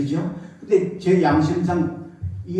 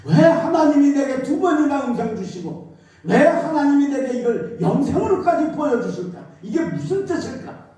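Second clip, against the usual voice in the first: higher pleading question; 8 semitones higher than usual. Retold or imitated.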